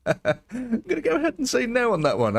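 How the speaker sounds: high, squeaky-pitched talking through real laughter; amused, high-pitched laughing voice; laughing refusal. Real, not acted. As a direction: barely get it out through laughter. Laughing at a brazen request.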